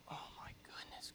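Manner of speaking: hushed mock exasperated whisper; whispered grumble. Acted muttering grumbler in church.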